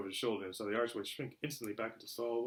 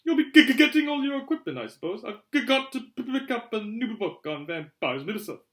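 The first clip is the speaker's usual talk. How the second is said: high, loud, stuttering professor; terrified stammer; 11 semitones higher, 10 dB louder than usual. A narrator's reading. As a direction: stammer nervously through small talk. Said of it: "He looked terrified".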